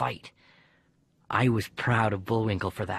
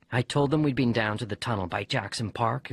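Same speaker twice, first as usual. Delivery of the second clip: ordinary delivery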